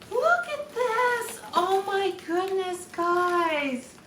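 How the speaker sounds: high, slow and thrilled; delighted look at this; excited. Real, not acted. Unboxing a gift, delighted.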